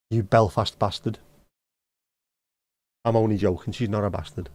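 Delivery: ordinary delivery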